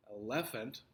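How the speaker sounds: "elephant" said el-LE-phant; stress on middle syllable. Shown as a demonstration. Teacher offers a second wrong option, "elephant" stressed el-LE-phant. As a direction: shift the weight of "elephant" onto its second syllable. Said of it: "Which one is emphasized".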